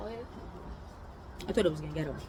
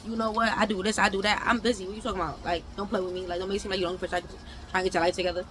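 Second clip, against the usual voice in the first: ordinary delivery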